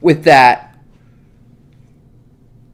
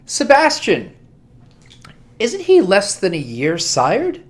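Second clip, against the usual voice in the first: higher, slow, pointed question; mocking prince; 7 semitones higher, slower than usual. The prince turns to Sebastian, slow and mocking. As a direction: needle him with a high, drawn-out challenge.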